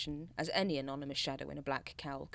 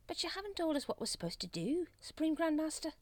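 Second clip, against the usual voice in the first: high-pitched whine; plaintive, high complaint; whining; 12 semitones higher than usual. A narrator's reading. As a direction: whine in protest at the boss. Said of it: "whined Brother Watchtower".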